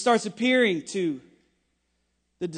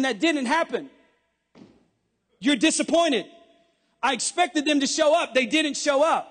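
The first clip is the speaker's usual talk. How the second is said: loud, high, shouted sermon climax; preaching at full force; 7 semitones higher, 6 dB louder than usual. Acted. Pastor shouting hope to a congregation, at full force. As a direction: preach it hard, building to a shout.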